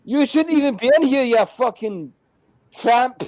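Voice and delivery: high, loud retort hurled back; shouted insult. Retold or imitated. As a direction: shout back at the squatter in re-enactment. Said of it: "I shouldn't have shouted that".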